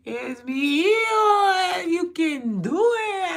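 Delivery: put-on high, slow, drawn-out cheer at a kid; loud motivating yell. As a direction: pump the kid up, stretched and loud. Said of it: "real loud this morning".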